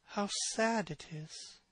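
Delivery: soft, slow murmur. A narrator's reading. Dorian murmurs to his own portrait, softly and slowly. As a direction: murmur it to yourself, gazing. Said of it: "murmured Dorian Gray".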